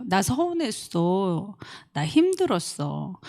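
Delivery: soft, slow, gentle admission; a soft, tender confession; gently said. Shown as a demonstration. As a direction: say it kindly and slowly. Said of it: "예쁘게 말을 하세요".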